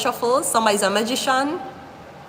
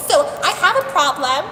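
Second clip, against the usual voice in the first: mock customer; 8 semitones higher than usual. Mock customer calling locksmith.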